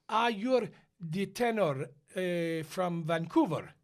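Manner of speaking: put-on slow, careful Italian-accented English. Rehearsal staff approach the new tenor, slow Italian accent. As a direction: drag out the words in an Italian accent.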